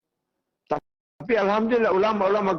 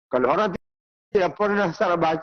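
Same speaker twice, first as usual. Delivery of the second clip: ordinary talk, nothing marked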